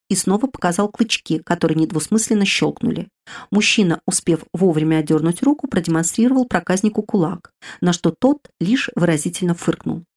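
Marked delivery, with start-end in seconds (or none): none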